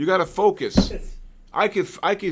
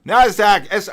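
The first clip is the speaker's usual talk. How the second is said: urgent call; 5 semitones higher, 9 dB louder than usual. Real, not acted. Calling out market move.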